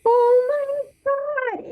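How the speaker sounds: high, slow, breathy gasp of disbelief; hushed high-pitched shock at seeing him; stunned oh my God. Retold or imitated. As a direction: let it catch in the throat, awestruck. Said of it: "I was like".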